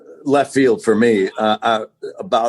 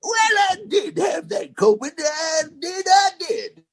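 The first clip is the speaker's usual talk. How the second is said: put-on old prospector voice. Answering in the old-timer podcast bit, a high prospector holler. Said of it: "did it that way?".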